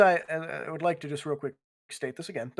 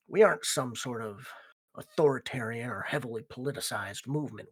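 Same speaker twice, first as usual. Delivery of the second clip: lower, softer in-character voice; measured biker gang leader; 3 semitones lower, 4 dB quieter than usual. Acted. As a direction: explain the code gravely, as a gang boss.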